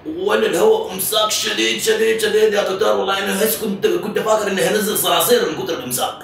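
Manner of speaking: exaggerated distress. Prank caller complains of constipation.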